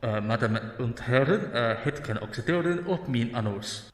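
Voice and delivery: a hint of a Dutch rail announcer reading over the speakers; Dutch train announcement. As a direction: play a Dutch station announcer with a giggle. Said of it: "sounded like the Tannoy announcement on the train".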